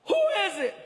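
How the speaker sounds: high, startled shout through a door; yelling. Acted. Man answers a knock on his hotel door, high yell. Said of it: "He yells".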